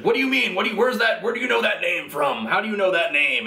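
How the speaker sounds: mock-furious interrogation, fading down; hammed-up enraged Batman; angry Batman. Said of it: "he's like".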